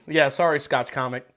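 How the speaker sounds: ordinary talk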